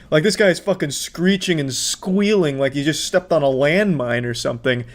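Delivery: ordinary delivery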